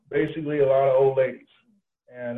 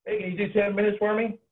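higher, breezy ask in Marty's voice; voicing the sponsor; 9 semitones higher than usual. Marty on the phone asking for a talk, higher breezy voice. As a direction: play the boss casually asking a favour. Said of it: "he goes".

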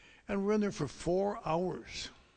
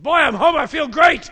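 much higher, loud and jubilant; elated shout; 11 semitones higher, 18 dB louder than usual. Retold or imitated. Sober doctor bursting through the door, elated shout. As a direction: burst in beaming with joy.

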